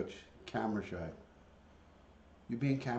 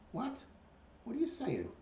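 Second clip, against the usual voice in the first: high-pitched cooing to a bird; pet-talk voice. Real, not acted. Owner answering a mumbling bird, high pet-talk. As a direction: ask the pet in a high little voice.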